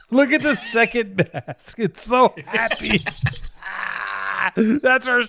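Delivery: high, loud laughing through words; real giggling fit; laughing hard. Real, not acted. Cracking up over drawing.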